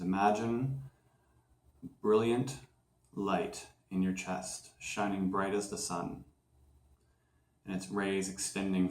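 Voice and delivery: unhurried, softened voice with pauses between phrases; guided meditation. Acted. Host guiding listeners through a clearing visualization, calm meditation voice.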